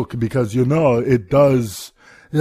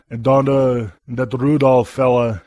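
ordinary talk

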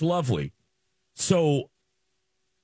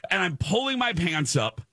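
high-pitched frantic retelling; freaking out; 7 semitones higher than usual. Retold or imitated. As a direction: relive the panic in a raised voice. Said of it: "I am now freaking out".

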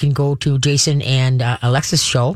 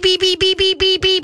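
much higher tiny peeping imitation of baby chicks; chick peeps. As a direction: peep it high like newborn chicks. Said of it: "all I hear is beep".

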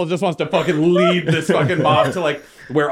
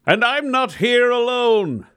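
slow, raised orator voice; rallying speech; 5 semitones higher, slower than usual. Acted. Reginald rallying the crowd, slow oration. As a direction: proclaim it slowly to the crowd.

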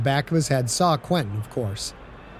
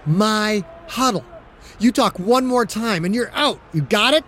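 threatening; 6 semitones higher than usual. Acted. Quarterback gripping the face mask, threatening the rookie. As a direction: lay down the law, leaning in.

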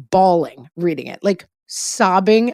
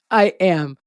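laughing; 4 semitones higher, much slower than usual. Real, not acted. Laughing while admitting crying more.

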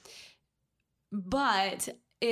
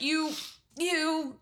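put-on high-pitched, louder childish insult; kid's mock insult; 8 semitones higher, 3 dB louder, slower than usual. Kid fumbling a silly insult in a high voice. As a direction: squeak it out like a kid name-calling. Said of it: "instead of being like".

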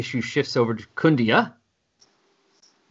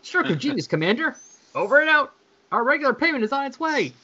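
high, chirpy relieved voices; cheery sign-off; 12 semitones higher than usual. Acted. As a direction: sign off brightly and hurry away.

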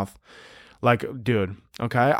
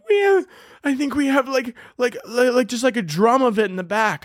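much higher, trembling mock squeak; mock panicked high voice; squeaky scared barista; 12 semitones higher than usual. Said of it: "she goes, she's like".

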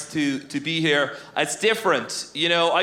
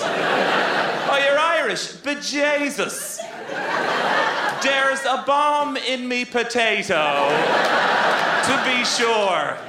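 hammed-up stage Irish accent, slow and pitched up; mock Irish accent; 4 semitones higher, slower than usual. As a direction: lay on a cartoon Irish accent, slow and laughing through it. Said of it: "love to do their hilarious impersonation of this accent".

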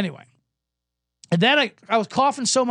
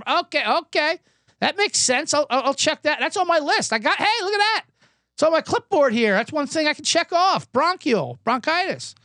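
much higher, pleased-with-himself doctor; a doctor proud of his clipboard, hammed up; mock cheery doctor; 7 semitones higher than usual. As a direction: chirp it like he just aced a checklist. Doctor ticks item off clipboard.

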